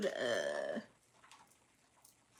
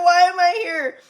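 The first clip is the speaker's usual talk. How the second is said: mock despair; 8 semitones higher, 14 dB louder than usual. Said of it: "You're like".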